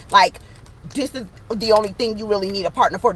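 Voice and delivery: ordinary talk, nothing marked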